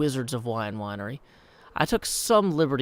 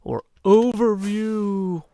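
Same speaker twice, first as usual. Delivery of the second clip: drawn-out overview; 7 semitones higher, 6 dB louder, much slower than usual. Pretend. Pun: overview, camera flies up.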